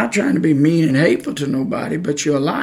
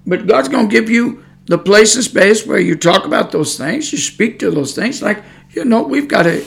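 higher-pitched, fervent preaching to the flock; rising preaching; 7 semitones higher than usual. Acted. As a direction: lift the voice and preach it to them.

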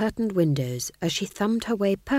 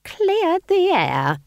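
high, very slow, strained hiss; 13 semitones higher, 5 dB louder, much slower than usual. A narrator's reading. Nanny hisses the last word of her false pleasantry. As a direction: stretch each word out, pitched way up. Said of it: "hissed Nanny Ogg".

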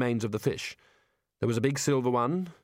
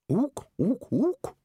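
higher puzzled ook ook; mock ape hoot; 10 semitones higher, much slower than usual. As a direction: copy the ape's hoots, unsure. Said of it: "Ridcully copying the ape".

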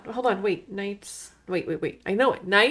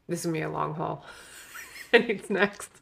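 usual speaking voice

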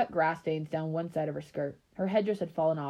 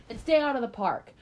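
higher warning called after them; stern parental order; 6 semitones higher, 4 dB louder than usual. A narrator's reading. Mother warns daughter leaving for trick-or-treating, stern. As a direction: lay down the rule.

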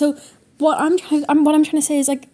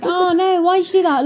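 put-on British accent, pitched up; 5 semitones higher than usual. Shown as a demonstration. Showing co-host a habitual impersonation, higher British accent. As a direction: lift it into a playful British accent. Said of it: "doing a British accent".

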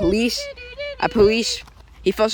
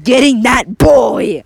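slow, loud, high shout; 5 semitones higher, 9 dB louder, much slower than usual. Acted. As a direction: yell it across the store. Villain shouting at crowd.

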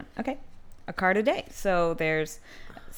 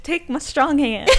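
mock dramatic; 8 semitones higher, 9 dB louder than usual. Joking shuffle bit.

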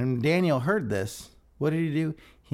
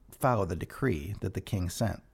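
normal talking voice

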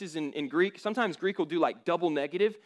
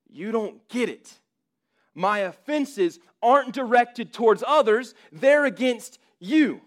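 louder, higher pleading protest; the sinner insisting he is unworthy; 5 semitones higher, 6 dB louder than usual. Acted. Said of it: "dialogue between a person".

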